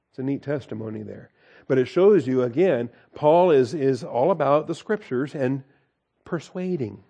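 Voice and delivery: ordinary talk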